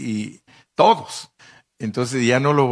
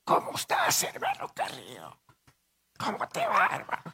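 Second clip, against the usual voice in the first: voiceless, strained hoarse greeting; mock lost voice, squeaky and faint; imitating a worn-out voice; 8 dB quieter than usual. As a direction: greet as if years of shouting wrecked your voice. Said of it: "toda su vida han predicado gritando y yo los miro ahora y están".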